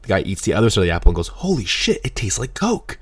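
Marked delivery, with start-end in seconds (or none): 1.3-3.0 high-pitched astonished customer; amazed. Retold or imitated. Customer tasting the apple's other side, acted high amazement. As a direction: act out wide-eyed disbelief, pitched up.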